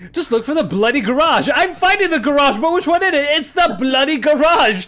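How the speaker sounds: high, loud mock British-Australian accent; hammed-up British-Australian voice; Anglosphere accent. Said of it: "Nice accent, by the way".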